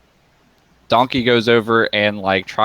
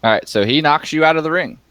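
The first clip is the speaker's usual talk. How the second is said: normal talking voice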